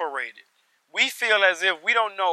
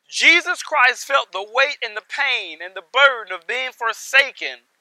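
ordinary talk, nothing marked

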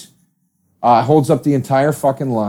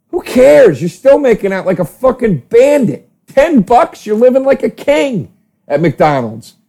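excited gush; 9 semitones higher than usual. Real, not acted. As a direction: sell McDonald's like a fan. Gushing about McDonald's.

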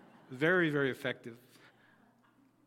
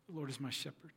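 much softer echoed prayer line; 8 dB quieter, faster than usual. Shown as a demonstration. Congregation echoing the pastor's psalm line, softly. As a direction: repeat it back hushed like the congregation. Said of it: "asked them to echo the words back to me".

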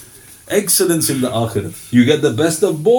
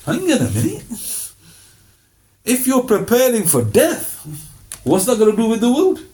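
much higher, doubting heckler voice; sceptical listener; 8 semitones higher than usual. Retold or imitated. Listener interrupting the preacher, in a high doubting voice. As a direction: play the doubter pushing back.

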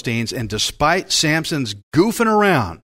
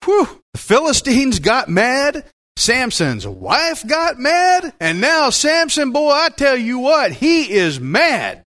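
excited build-up; 9 semitones higher than usual. Acted. Preacher building up the anger story.

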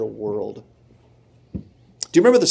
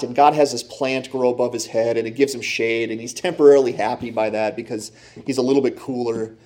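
normal talking voice

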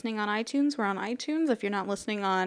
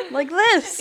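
laughing; 7 semitones higher, 8 dB louder than usual. Real, not acted. Mimicking peering through magnifying glass.

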